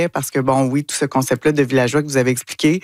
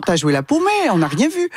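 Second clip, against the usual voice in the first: higher mock quote of "T'as joué la paumée"; lightly imitating the French players; 6 semitones higher than usual. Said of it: "c'est leur expression".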